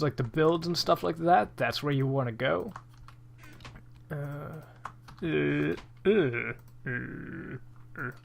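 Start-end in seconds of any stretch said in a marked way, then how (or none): 4.1-8.1 whale voice. Pretend. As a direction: moan like a whale calling out. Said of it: "that's my, um, whale voice".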